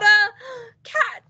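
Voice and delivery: gasp, then a high surprised word; storyteller's surprised reveal; dramatic reveal. A narrator's reading. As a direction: build up and reveal it.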